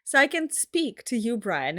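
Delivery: normal talking voice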